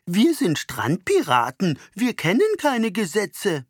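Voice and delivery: slow, high, haughty mockery of kids; snooty voice. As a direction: sneer like a stuck-up child. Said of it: "Mit hochnäsiger Stimme". (hochnäsige Stimme)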